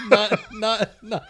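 laughing through the words; words broken by laughter. Real, not acted. Laughing at his own mixup.

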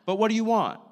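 high, loud question; exaggerated loud, high demand; mock demanding. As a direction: throw it out loudly as a challenge. Repeats the demanding reading of quote.

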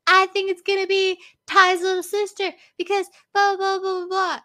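put-on high childlike voice rattling on; imitating daughter. Mom imitating her daughter's guess, in a high kid voice. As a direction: chirp it out like an eager kid. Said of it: "And she's like".

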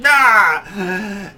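sudden high, loud cry of shock; startled yelp. Retold or imitated. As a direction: jolt as if just hit. Reacting to punch on nose.

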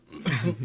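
real coughing fit after swallowing water. Real, not acted. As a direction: cough hard, choking on water. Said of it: "I'm choking on water here".

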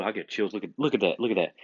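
ordinary talk

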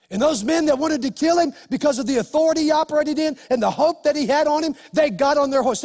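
high, loud, driving sermon climax; full-force preaching. Acted. Pastor builds the congregation to a victory climax, preaching loud. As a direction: preach it to the back row.

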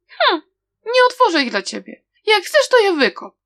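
high, loud, fast defiant retort; spat out forcefully. A narrator's reading. Frightened girl defies the man at the door, loud and sharp. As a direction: fling the refusal at him with bravado. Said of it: "wypluła silnym tonem głosu".

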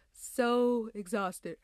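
slow words stretched by a yawn. Real, not acted. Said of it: "that's why I'm still yawning right now".

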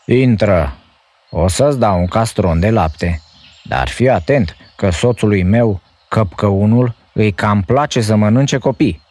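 high, friendly giant wife voice; softened giantess. A narrator's reading. Giant's wife inviting the hungry boy in. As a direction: welcome the boy kindly but warn him. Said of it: "îl privi îmbunată".

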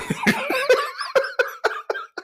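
high, real cackling fit; laughing. Real, not acted. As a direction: crack up at your own impression. Cracks up at his imitation.

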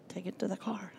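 a quiet, insistent whispered command; hushed whisper. Retold or imitated. Holy Spirit directing her to the car, soft whisper.

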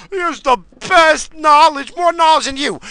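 high, loud mock-reverent voice; mocking imitation. Host parroting those who praise the politician, mockingly. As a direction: parrot the defenders in a high, stretched voice.

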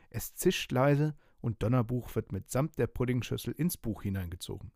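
ordinary delivery

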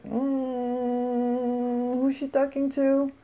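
high, sassy inner voice; offended inner voice. Retold or imitated. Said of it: "So in my head, it starts like".

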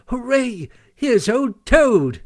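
high, delighted cheer; beaming cry. A narrator's reading. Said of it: "cried the Mole, his face beaming".

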